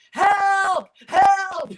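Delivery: loud, high-pitched cry for help; yelling for help. Retold or imitated. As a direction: yell it out like a kid calling for rescue. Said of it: "I'm just yelling".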